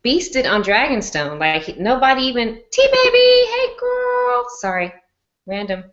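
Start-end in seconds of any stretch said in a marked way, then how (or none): 2.7-4.4 very high, loud, drawn-out hello; excited high-pitched greeting; squealed greeting. Real, not acted. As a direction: squeal hello to a friend who just arrived. Squealed greeting to arriving friend.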